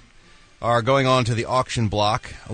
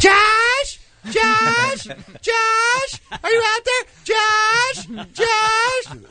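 calling from far away; much slower than usual. Pretend. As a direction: shout his name into the distance, rising each time. Wife calling distracted husband.

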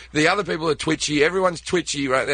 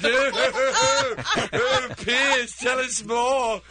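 higher, gushing fan voice; swooning Americans; 9 semitones higher, much slower than usual. Retold or imitated. Americans gushing over a British accent, higher and dreamy. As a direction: gush like a smitten fan. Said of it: "you people were like".

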